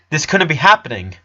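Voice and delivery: usual speaking voice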